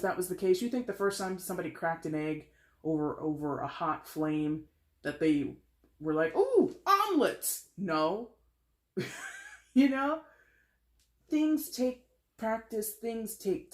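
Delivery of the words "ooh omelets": high, loud, drawn-out fake amazement; mock delight. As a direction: exclaim slowly in pretend wonder. Mock delighted first egg cook.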